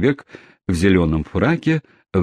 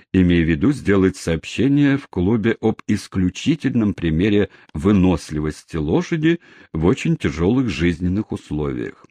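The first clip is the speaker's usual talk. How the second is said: plain reading voice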